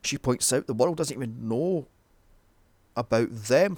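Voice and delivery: ordinary talk, nothing marked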